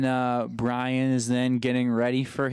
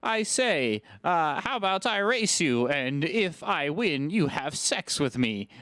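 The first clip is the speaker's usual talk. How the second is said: higher, hammed-up gentleman voice; mock gentleman; 6 semitones higher than usual. Rude gentleman propositioning the woman racer, hammed-up formal voice. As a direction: play an absurdly formal gentleman. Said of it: "a very rude gentleman".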